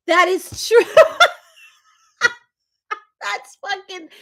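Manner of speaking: high, breathless laughter through the words; cracking up at the joke; laughing hard. Real, not acted. Streamer laughing at a viewer's comment.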